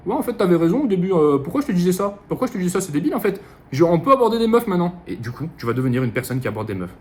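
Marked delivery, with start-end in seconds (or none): 0.1-5.0 higher little inner voice, coming round; petite voix convertie. Acted. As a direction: play an inner voice admitting it was silly. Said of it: "la petite voix, elle va te dire". (petite voix aiguë qui se laisse convaincre)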